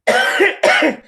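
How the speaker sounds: sudden high loud burst of laughter. Real, not acted. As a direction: crack up loudly.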